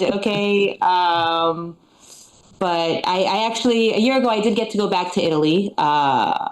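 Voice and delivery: normal talking voice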